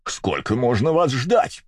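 high, slow, exasperated cry; impatient shout at latecomers; nervous outburst. A narrator's reading. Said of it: "вскричал нервно". (нервный раздражённый возглас)

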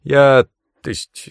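high, loud, halting stammer; hesitant, strained start; forced out. A narrator's reading. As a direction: squeeze the words out nervously. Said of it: "выдавил из себя наш проводник".

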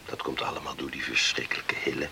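whisper. Acted. Talking in bed at night.

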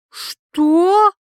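loud, high, incredulous cry; shocked outcry. Acted. Wife hears her husband called bad, shocked and loud. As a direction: burst out in rising disbelief.

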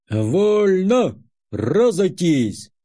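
loud barked army orders; shouted commands. Acted. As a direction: bark the order across the parade ground. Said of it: "крикнул командир отряда".

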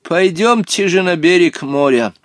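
put-on high, drawn-out old-man urging; impatient Hottabych. A narrator's reading. Old companion urging the boys to the shore, impatient. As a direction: urge them on impatiently. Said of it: "нетерпеливо предложил Хаттабыч".

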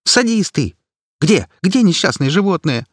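high, loud indignant yell; outraged shout. Acted. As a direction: demand answers at full pitch. Activist accusing.

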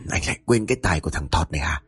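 low, quick character voice; amused boasting. A narrator's reading. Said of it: "cười cười rồi lên tiếng".

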